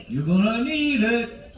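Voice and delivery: high, drawn-out playful warning; teasing warning. Pretend. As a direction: stretch it out as a mock threat.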